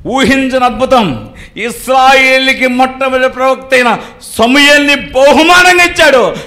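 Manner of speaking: full-force sermon voice; fervent preaching. Acted. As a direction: preach it out to the congregation. Preacher announces the miracle.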